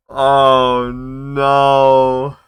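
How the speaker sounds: loud dismay. Real, not acted. Player dreading the wrong partner, loud dismay. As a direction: cry out in mock despair.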